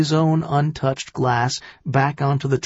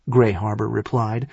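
usual speaking voice

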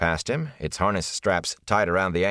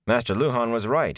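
ordinary delivery

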